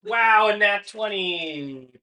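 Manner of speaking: slow, loud and high amazed wow; excited. Real, not acted. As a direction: savour the big roll. GM reacts to critical roll.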